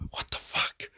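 breathless whispered what the fuck; whispered disbelief. Real, not acted. Telling viewers about the creep, stunned whisper. Aside after the shocking offer.

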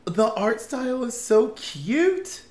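squealed high and loud, slow; high, slow squeal over a cute cartoon; gushing. Real, not acted. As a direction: coo over it like something adorable.

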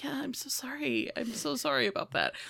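very soft imitated apology; mock meek apology. Mother who always says sorry, mock meek and soft.

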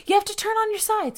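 urgent instruction; urgent order. Retold or imitated. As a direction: nurses ordering her urgently. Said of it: "they start going".

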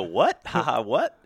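loud incredulous what with a laugh; a brother stunned and amused at the miss; laughing disbelief. Retold or imitated. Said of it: "And you go".

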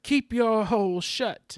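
high, loud, drawn-out retort; hammed-up angry order, words stretched out; mock angry. Podcaster acting out a mad character's retort, hammed-up anger. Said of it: "that's what he gets mad about".

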